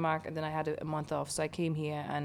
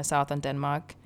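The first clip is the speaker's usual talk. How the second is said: normal talking voice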